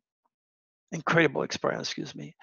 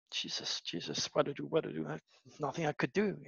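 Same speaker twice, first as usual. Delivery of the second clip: helpless muttering; 10 dB quieter than usual. Retold or imitated. Friend left helpless after the goodbye call, quiet despair. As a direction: murmur it to yourself, at a loss.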